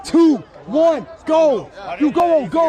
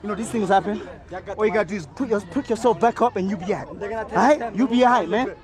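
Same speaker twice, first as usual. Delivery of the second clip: soft comforting; 9 semitones lower, 5 dB quieter than usual. Real, not acted. Comforting the loser.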